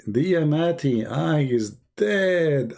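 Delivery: stretched-out mock realisation; exaggerated realisation. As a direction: let the penny drop slowly and theatrically.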